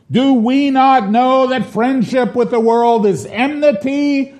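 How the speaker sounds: high, thundering shout; prophetic unction. Acted. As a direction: denounce them like an Old Testament prophet. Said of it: "delivered with prophetic unction".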